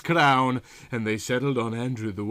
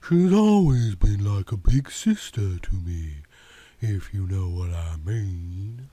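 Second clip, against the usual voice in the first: Yvonne's voice; 4 semitones lower than usual. A narrator's reading. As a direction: play her sultry and unhurried.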